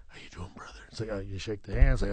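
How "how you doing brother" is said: barely voiced throwaway greeting; muttered greeting. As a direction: toss off a rote hello under your breath. Greeting guys at catering.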